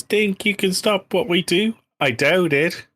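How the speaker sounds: put-on a playful Irish-accented taunt sung like the kids; imitating Irish kids. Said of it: "the Irish kids, and they have a song, and it goes".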